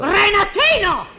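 sharp, high call of his name. Acted. Mother calling her son down, sharp high call. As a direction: snap his name out to make him answer.

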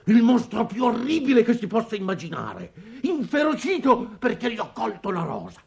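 high, mounting panic; terrified outburst. Acted. Father reliving the monster's threat over the rose, in rising terror. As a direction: cry out and let the dread climb.

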